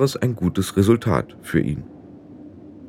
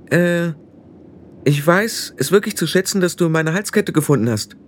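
put-on higher-pitched girl, hesitant and apologetic; halting, higher voice, searching for polite words; Tiffany, awkwardly polite; 7 semitones higher than usual.

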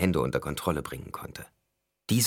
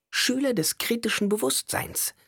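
caustic mockery. A narrator's reading. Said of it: "Ihr Ton war von ätzender Schärfe".

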